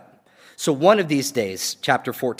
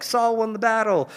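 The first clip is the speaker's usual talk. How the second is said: high, sustained mock fanfare; tooting his horn; 8 semitones higher than usual. Preacher mimics Saul claiming Jonathan's victory, high boastful crowing. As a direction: crow it out high like a herald. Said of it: "toots the horn".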